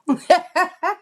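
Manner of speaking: loud, high laugh of disbelief; high burst of laughter. Real, not acted. Laughing loudly at a friend's unbelievable message. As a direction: laugh out loud in real amusement.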